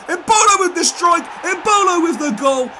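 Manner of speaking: high, loud excited goal call; shouting the scorer's name as the ball goes in. Real, not acted. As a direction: call the goal like a commentator going wild. Commentating his own goal.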